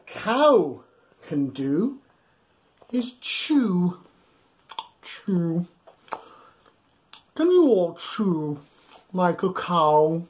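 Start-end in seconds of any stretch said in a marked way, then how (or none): none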